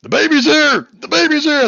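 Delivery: high, loud cry to the crowd; screamed alarm. Acted. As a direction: raise the alarm at the top of the voice. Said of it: "one of 'em screams".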